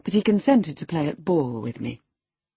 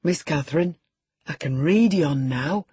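lightly voiced proud country lad; a touch of clumsy pride in reading; mild awkward elation; awkward and elated. A narrator's reading. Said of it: "with a stupid mixture of awkwardness and elation".

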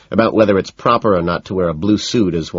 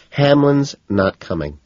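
flat; 8 semitones higher, slower than usual. A narrator's reading. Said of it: "she says again, flatly".

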